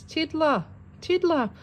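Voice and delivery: teacher calling out. Acted. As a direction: call the missing pupil, then declare him late. Teacher calling register, Tiddler absent.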